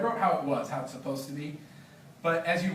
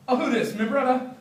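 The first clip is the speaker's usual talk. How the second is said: much higher, louder, jovial Jamaican greeting; hearty Biga; 8 semitones higher, 6 dB louder than usual. Acted. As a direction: welcome the newcomer with a big grin. Biga hails Todd, chuckling.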